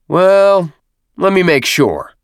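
drawn-up "well", projected; loud showy announcement. A narrator's reading. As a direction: announce it for the whole platoon. Captain addressing all the recruits loudly.

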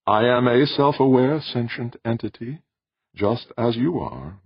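high, composed tenor; sentient machine. A narrator's reading. Machine corrects the visitor on what it is, composed tenor. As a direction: speak as a self-assured machine, pitched high.

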